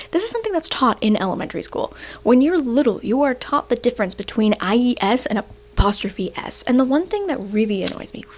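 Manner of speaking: normal talking voice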